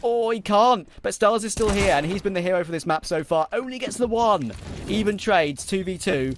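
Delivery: excited play-by-play; esports caster. Acted. Live play-by-play during a clutch round.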